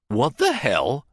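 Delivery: shocked. Acted. Bartlett reacts in shock to the drugged Jake, slow and stunned. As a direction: drag it out in disbelief. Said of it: "Bartlett looked equally shocked".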